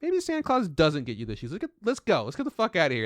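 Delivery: high, exasperated snap; mock a dad losing patience; fed-up dad. Imitating a dad fed up with his son in GameStop. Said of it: "the dad's like".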